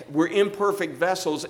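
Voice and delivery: ordinary delivery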